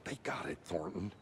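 low, soft reply into the radio; muted aside. Acted. Deputy answers his partner on radio, muted aside. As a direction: hush a partner quietly.

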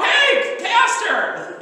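loud high mock visitor call. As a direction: call out to the pastor through the door. Imagined visitor calling out.

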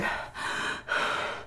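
heavy voiceless gasps; imitated struggle to draw air; labored breathing. Sick woman in the car, labored breathing. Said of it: "that's how I was breathing".

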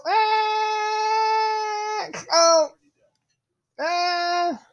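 high yelps on the drop; screaming while falling. Real, not acted. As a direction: cry out as you fall. Last jump, falling.

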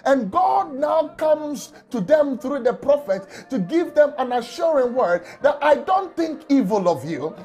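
usual speaking voice

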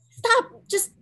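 sharp high cry of stop; panicked stop. Acted. Girl to friend grabbing the letter, a high panicked shout.

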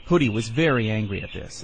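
plain reading voice

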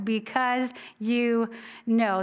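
know said slow and weighty; stress on know. Shown as a demonstration. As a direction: drag out know slowly for emphasis. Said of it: "underline that word know".

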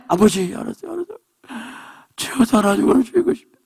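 high, strained voice giving in; pleading believer. Acted. Believer answering God, giving in with a high plea. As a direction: beg and yield at once.

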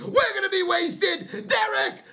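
yelling high and strained, almost cracking; rally the crowd to go out in the rain; fired-up fan hollering at Derek to show up; excited high shout. Real, not acted. Rallying fans for the game.

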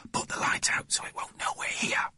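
urgent hushed whisper; very soft, all breath. A narrator's reading. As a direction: whisper the instruction while hiding. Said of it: "whispered Jeremy James".

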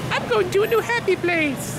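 very high cartoon lobster voice; squeaky lobster voice. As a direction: squeak it like a happy little lobster.

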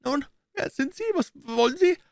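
high, nervous little man; unsure voice. A narrator's reading. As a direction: question the stranger uncertainly. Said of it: "mit unsicherer Stimme". (mit hoher, unsicherer Stimme)

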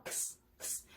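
"크스" as a voiceless ks; whispery k released into s for 크스; just the consonants k-s, no voiced vowel in "크스"; X sound. Shown as a demonstration. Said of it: "쉽게 발음을 하기에는 크스".

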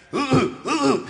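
loud high ape hoots; man mocking the gorilla with loud hoots. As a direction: hoot up high like a taunting ape. Man mimics ape grunts.

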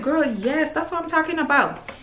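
put-on high-pitched, hyped-up girlfriend talk; playful high cheer of approval; delighted agreement. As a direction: agree gleefully, pitched right up. Acting out her delighted reply.

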